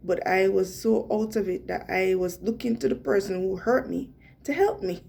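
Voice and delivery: normal talking voice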